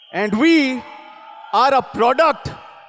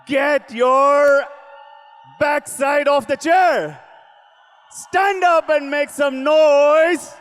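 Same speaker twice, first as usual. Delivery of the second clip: rousing crowd call, pitched up; booming motivational speech; 5 semitones higher than usual. Real, not acted. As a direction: get them on their feet.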